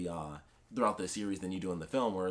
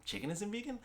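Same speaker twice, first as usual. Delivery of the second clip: mock shock; 11 semitones higher, 5 dB quieter than usual. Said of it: "He's just like".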